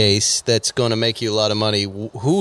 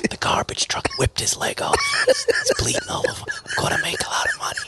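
hushed, scheming stage whisper; mock gleeful whisper; 3 dB quieter than usual. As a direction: whisper it like a secret windfall. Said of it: "then he talks like that".

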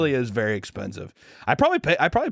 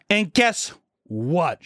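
very slow, drawn-out "And guess what?"; mock teacher, slowly drawn out; slow quote; 4 semitones higher, much slower than usual. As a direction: stretch each word like a teasing reveal. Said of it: "She says that".

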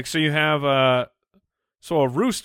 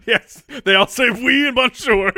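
high, loud French caricature; hammed-up French voice; mock French accent; 9 semitones higher, 7 dB louder than usual. Mock French in comedy podcast.

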